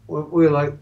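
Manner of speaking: usual speaking voice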